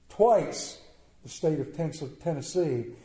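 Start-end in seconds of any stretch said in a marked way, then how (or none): 0.1-0.8 "twice" said higher and louder, falling; stressed twice. Real, not acted. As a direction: hit "twice" harder.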